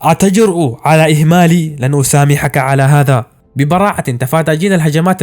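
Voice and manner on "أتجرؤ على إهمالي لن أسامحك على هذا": ordinary delivery, nothing marked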